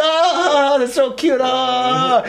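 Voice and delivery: put-on high cooing girly voice; cooing girls. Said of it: "They're like".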